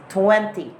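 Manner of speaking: "twenty" with a firm second T; British clear T. Shown as a demonstration. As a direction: give both T's in "twenty" emphasis. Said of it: "T has given emphasis".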